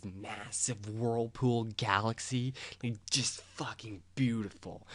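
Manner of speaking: whispering in wonder; awed whisper. Real, not acted. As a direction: breathe it out in awe. Awed by the galaxy.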